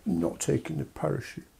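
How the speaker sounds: put-on soft, sulky child refusal. UK as child refusing, sulky mutter. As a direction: mutter it like a pouting kid. UK sulking.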